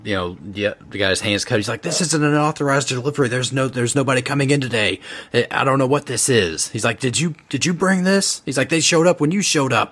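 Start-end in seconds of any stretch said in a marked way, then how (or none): 1.8-9.9 higher-pitched, urgent government man; higher, agitated official voice; rattled agent Davis. Acted.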